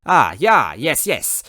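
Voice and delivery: eager agreeing chef; quick happy yes-man. Acted. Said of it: "the chef agreed happily".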